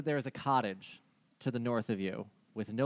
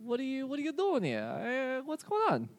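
reindeer NPC voice; 9 semitones higher than usual. Acted.

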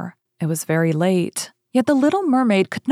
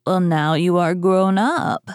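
put-on imperious old money matriarch. Grandmother addresses the princess.

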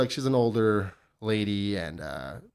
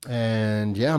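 ordinary talk, nothing marked

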